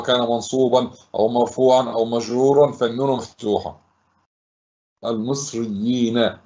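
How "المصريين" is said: المصريين stretched out slowly; slow reading. Shown as a demonstration. Teacher rereading the word before splitting it, slowly. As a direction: read the word unhurriedly, every part distinct. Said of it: "اقرأها أولًا على مهل".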